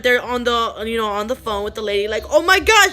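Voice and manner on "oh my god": high, loud cry of alarm; panicked outburst, re-enacted; retold frantic exclamation. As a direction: imitate a mother frantic on the phone. Mother frantic on emergency call.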